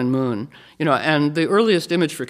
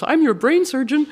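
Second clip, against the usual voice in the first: "I'm your brain surgeon" pitched high, rising like a question; uptalk; 11 semitones higher than usual. Acted. Speaker mimics maiden calling herself brain surgeon, high uptalk. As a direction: lift "surgeon" upward as if unsure of it. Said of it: "the voice that goes up at the end of the sentence".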